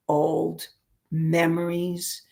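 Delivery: ordinary talk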